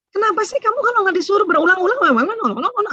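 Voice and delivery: high, fast exasperated nagging; nagging mother. Retold or imitated. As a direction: scold the kid like an exasperated mum. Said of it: "Ah, itu ibunya".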